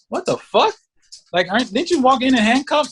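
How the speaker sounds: indignant mock disbelief; mock incredulous. Acted baffled jailed men.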